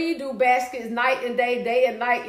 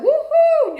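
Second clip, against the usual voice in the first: high, loud cheering woohoo; excited whoop reading a friend's comment; 14 semitones higher, 8 dB louder than usual. Retold or imitated. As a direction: cheer it like a fan.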